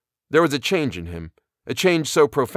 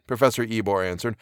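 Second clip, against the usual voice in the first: plain reading voice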